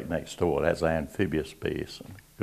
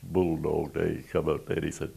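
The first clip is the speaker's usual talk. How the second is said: ordinary talk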